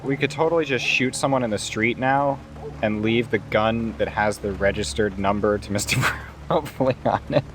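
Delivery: ordinary talk, nothing marked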